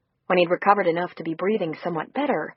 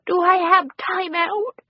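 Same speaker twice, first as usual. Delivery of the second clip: put-on much higher, slow, small teary child voice; pitiful little girl; 12 semitones higher, 4 dB louder, slower than usual. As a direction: ask it like a scared child near tears. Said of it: "Her breath caught pitifully".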